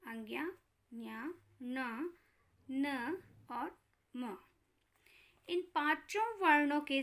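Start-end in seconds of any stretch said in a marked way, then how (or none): 0.1-4.4 letters drawn out slowly and softly; teacher slowly reciting the fifth letters; slow listing. Shown as a demonstration. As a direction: spell each nasal letter slowly for children. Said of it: "कौन कौन से हैं?".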